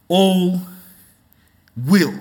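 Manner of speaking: preacher listing all and will with emphasis; emphatic words. Shown as a demonstration. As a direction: hammer all and will one by one. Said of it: "Three emphatic words".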